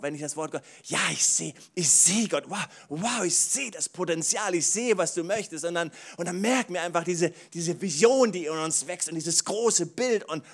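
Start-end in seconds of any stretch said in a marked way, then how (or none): 0.8-5.6 laut und hoch, voller Staunen; begeistert. Pretend. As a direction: wie jemand, der plötzlich Gott sieht. Nelly sieht begeistert Gott. (laute, hohe Begeisterung)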